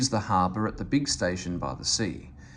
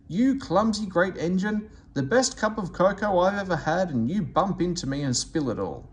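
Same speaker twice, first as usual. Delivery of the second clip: higher, exasperated scolding fireman; indignant scolding; 6 semitones higher than usual. Acted. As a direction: tell off the clumsy engine. Fireman scolds engine over spilled cocoa.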